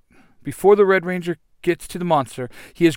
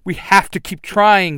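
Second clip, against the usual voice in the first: raised, loud urging; urgent yell; 6 semitones higher, 7 dB louder than usual. A narrator's reading. Yellow Ranger pinned down with the team, urgent high yell. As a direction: yell to spur the others on. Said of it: "the Yellow Ranger yells".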